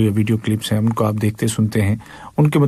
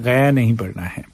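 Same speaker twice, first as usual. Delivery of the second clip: normal talking voice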